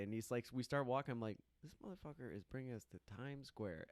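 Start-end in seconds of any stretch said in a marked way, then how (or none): 1.6-3.9 hushed, much softer disbelief; grumbled aside. Retold or imitated. Newcomer privately griping about friend's Times Square outing, hushed aside. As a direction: say it under your breath, privately appalled.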